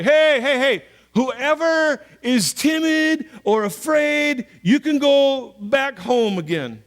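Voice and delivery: loud, slow call to the troops. Retold or imitated. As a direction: shout it out across the camp. Gideon calling out to army.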